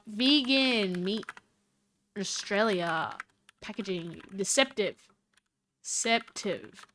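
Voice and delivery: soft slow typing-along voice; dictating search terms. Real, not acted. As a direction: murmur each keyword as you type. Says search terms while typing.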